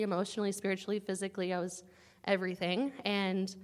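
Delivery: ordinary talk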